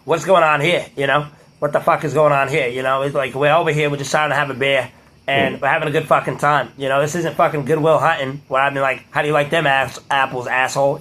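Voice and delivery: sweary Boston bar talk; imitation Boston local; Boston accent. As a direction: put on a casual Boston accent, dropping the r's. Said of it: "talking in a Boston accent".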